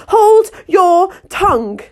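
high, slow, loud royal command; furious queen. A narrator's reading. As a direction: bark it down at Alice. Queen orders silence.

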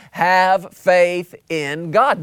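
slow, each word weighty; slow emphatic answer. Acted. Preacher hammering home the answer, slow and emphatic. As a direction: drag it out with full conviction.

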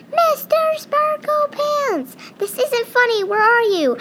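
very high, loud, slow frantic call; a mouse frantically calling for his friend; anxious calling. Acted. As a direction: shout for him, getting worried.

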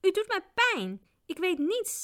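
high, pained whine; high-pitched whimpering protest; wailing. A narrator's reading. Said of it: "Hij jammerde zo luid".

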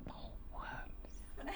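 breathy giggling whisper; laughing whisper. Real, not acted.

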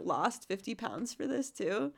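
ordinary talk, nothing marked

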